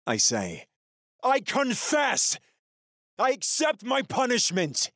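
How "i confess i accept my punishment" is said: desperate confession. Acted. The prisoner shouting his forced confession at the camera.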